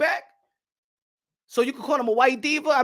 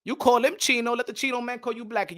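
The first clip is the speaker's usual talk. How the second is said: ordinary delivery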